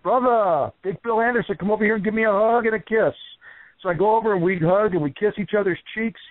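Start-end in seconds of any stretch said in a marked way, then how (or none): 0.0-3.1 higher, booming wrestler's greeting; hammed-up big-hearted brother call; imitating Jimmy Valiant. Jimmy Valiant inviting Bill over for a hug, imitated higher. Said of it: "And he goes".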